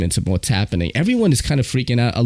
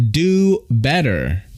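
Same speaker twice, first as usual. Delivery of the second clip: slow, higher-pitched "do better"; drawn-out scolding; 4 semitones higher, much slower than usual. Real, not acted. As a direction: stretch it out as a reproach. Drawn-out plea to transit agency.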